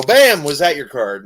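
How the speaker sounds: loud, punched magician's reveal; showy reveal. Retold or imitated. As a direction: hit it like a big trick reveal. Retells his trick's big reveal.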